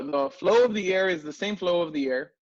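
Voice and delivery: ordinary talk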